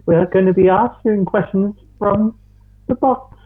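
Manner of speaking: very high put-on lady voice; Factual Fanny. Host announces the box questions as a high-pitched alter ego. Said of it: "I am Factual Fanny".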